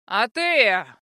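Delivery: loud drawn-out shout; yelling. A narrator's reading. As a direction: bellow her name from behind. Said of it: "проорал позади чёрный".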